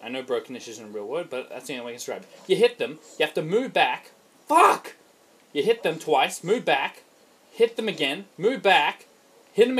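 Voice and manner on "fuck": sudden high, loud curse; frustrated yell. Real, not acted. Gamer caught out mid-combat, frustrated yell.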